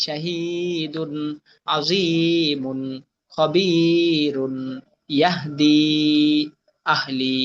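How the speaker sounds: very slow words with long held vowels; pronunciation model. Shown as a demonstration. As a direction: model each word, drawing out the long vowel. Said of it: "আমি এগুলা উচ্চারণটা বলে দিই". (টান দিয়ে ধীরে উচ্চারণ দেখানো)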